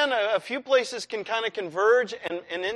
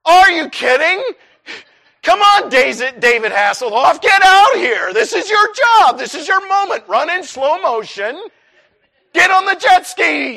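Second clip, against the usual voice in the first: high, loud mock outrage; hammed-up shouted retort; incredulous yelling; 9 semitones higher, 9 dB louder than usual. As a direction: goad a useless lifeguard at full volume. Acts his yelled retort to lifeguard.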